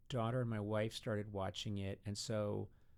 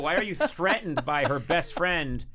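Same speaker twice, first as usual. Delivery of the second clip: loud, raised-pitch protest; mock exasperation; 8 semitones higher, 10 dB louder than usual. Daughter defending her show to dad, mock exasperation. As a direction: complain like a badgered kid. Continues daughter's indignant retort.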